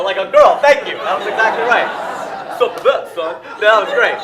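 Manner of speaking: loud, high mock gushing; shouted thanks. Comic praising a girl over a guy, shouted.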